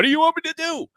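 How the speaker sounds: high, helpless whiny voice; hammed-up high whine; mock Tony Khan. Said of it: "And Tony Khan said".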